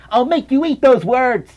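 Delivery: hammed-up game rival line; Shadow taunt. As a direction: throw down the challenge like an edgy hedgehog. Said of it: "He said something like that".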